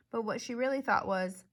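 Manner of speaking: ordinary delivery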